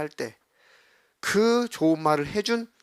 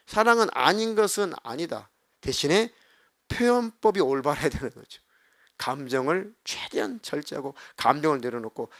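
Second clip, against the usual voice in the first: normal talking voice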